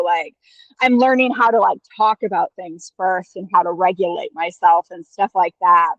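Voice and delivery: ordinary talk, nothing marked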